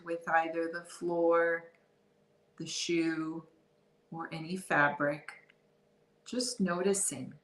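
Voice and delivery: guided meditation. Real, not acted. Guide leading listeners through a body scan, guided meditation. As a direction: ease them into awareness, unhurried and soft.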